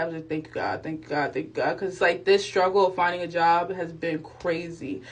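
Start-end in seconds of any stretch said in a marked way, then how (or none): 0.3-1.8 silent prayer. Retold or imitated. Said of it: "Like, in my head".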